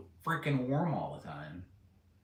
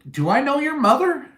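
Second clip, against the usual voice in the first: high, loud, caught-off-guard question; startled question; 12 semitones higher, 8 dB louder than usual. Retold or imitated. To a strange girl at the door, a startled high blurt. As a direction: blurt it out in shock. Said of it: "the first words out of my mouth was".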